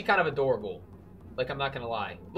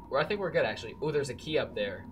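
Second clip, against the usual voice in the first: ordinary delivery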